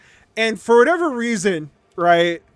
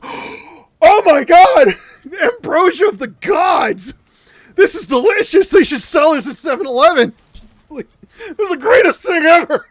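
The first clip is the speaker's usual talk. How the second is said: high, loud, over-the-top raving; mock rapture, belted out in a raised voice; hammed-up ecstasy; 7 semitones higher, 7 dB louder than usual. Reliving ecstasy over lemon swabs.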